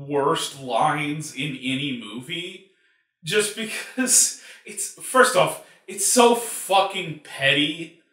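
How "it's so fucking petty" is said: usual speaking voice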